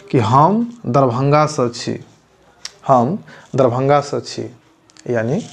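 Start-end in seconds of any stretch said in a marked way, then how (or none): 0.2-4.5 हम दरभंगा सँ छी said slowly and clearly; slow model reply. Shown as a demonstration. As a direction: model the answer slowly for learners. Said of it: "दूसरा व्यक्ति आपको बोलेगा".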